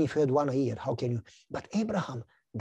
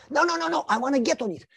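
put-on much higher child's voice, stubborn; little boy insisting; 9 semitones higher, faster than usual. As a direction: insist like a pushy toddler. Son insists on real bike.

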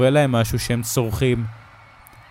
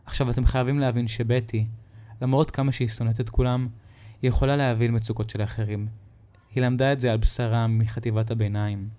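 usual speaking voice

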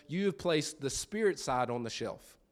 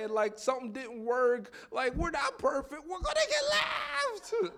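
put-on high-pitched childish panic, building to a wail; hammed-up child meltdown, climbing to a squeal; mock crying kid; 10 semitones higher than usual. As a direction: imitate a tearful kid spiraling into despair. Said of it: "Mom, I'm still crying".

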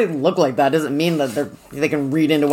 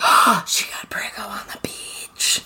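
gasping whisper; 5 semitones higher, slower than usual. Retold or imitated. Host retelling her shocked reaction to the book, whispering. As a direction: gasp it out like a shocking secret. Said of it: "And I was like".

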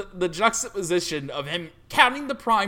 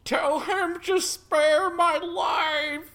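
higher, slow desperate begging; pleading guard; 10 semitones higher, much slower than usual. Pretend. The guard begs the priest to save him. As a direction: drag out the plea for mercy.

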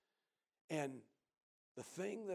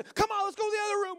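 much higher, louder and fast; eager hurry; 14 dB louder, faster than usual. Retold or imitated. Jesse hurrying guests to the next room, high, loud, fast. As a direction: rush them along like a kid.

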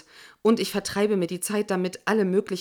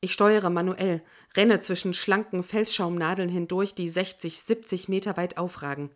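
ordinary delivery

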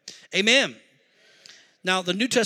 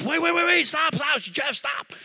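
much higher, urgent stop-stop cry; a wife trying to break up a fight; high pleading wait wait stop; panicked plea; 8 semitones higher than usual. Retold or imitated. Wife jumps between two men.

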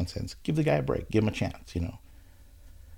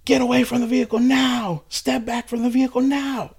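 much higher and louder barked command; police officer yelling; 7 dB louder than usual. Retold or imitated. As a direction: shout orders like a tense cop. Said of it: "she yells".

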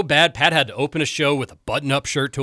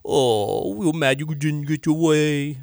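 mocking baby voice. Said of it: "hear your annoying baby voice".

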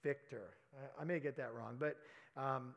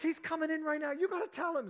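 high and loud, eager, imitating her; excited; 14 semitones higher, 8 dB louder than usual. Wife urging the prophet to tell her husband, excited. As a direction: urge it on, excited.

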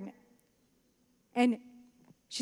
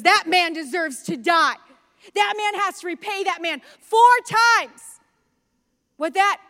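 angry; 6 semitones higher, 7 dB louder than usual. Retold or imitated. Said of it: "David is angry and says".

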